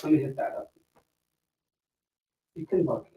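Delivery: ordinary talk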